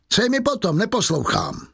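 high-pitched, dismissive retort; scornful refusal. A narrator's reading. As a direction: brush him off with pitch leaping up. Javert refuses to listen.

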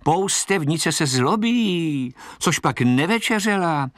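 drawn-out, high jeer; lightly voiced mocking children; children shouting. A narrator's reading. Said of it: "křičeli a nezřízeně se smáli".

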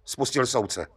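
plain reading voice

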